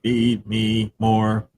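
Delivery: very slow robotic echo; toy robot voice. Pretend. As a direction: drag it out like a robot. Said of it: "I am a robot".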